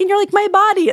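mocking impression. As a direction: nephew teasing aunt. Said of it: "he's like".

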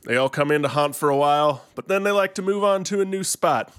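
lightly played Kentucky ghost exterminator accent, unfazed; a hint of a Kentucky old-timer who's seen it all; Kentucky twang, laid-back and sure of himself. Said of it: "but you did the accent".

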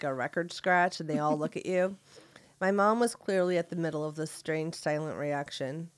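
normal talking voice

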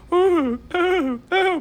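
put-on high, fluttery Julia Child; sing-song Julia Child ooh; Julia Child impression. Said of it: "Ooh, I like that impression".

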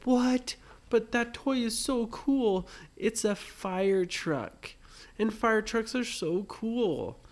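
Brady pleading to keep the fire truck, eager; eager little boy. Acted. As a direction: beg like a small boy who wants it. Brady whines for the toy.

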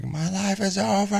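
high melodramatic wail; melodramatic kid. Retold or imitated. Dad imitating his daughter's melodrama over a cramp. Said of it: "just catch a cramp one day and be like".

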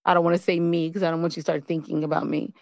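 ordinary talk, nothing marked

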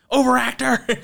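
very high, loud mocking jab; mock insult. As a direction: hurl it as the prosecutor. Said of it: "She goes".

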